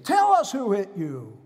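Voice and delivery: loud jeering demand, falling away; retold scream. Mockers taunting the man they hit, loud retold yell. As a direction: imitate a mob yelling at its victim. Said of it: "they begin to scream at him".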